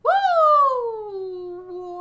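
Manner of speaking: loud, high cheer; excited whoop. Real, not acted. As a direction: whoop with delight. Big cheer at a result.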